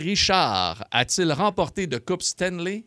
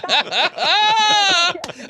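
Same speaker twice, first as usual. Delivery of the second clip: high shrieking laugh; 11 semitones higher, 4 dB louder than usual. Real, not acted. Host cracking up at the caller's story, high shrieking laugh.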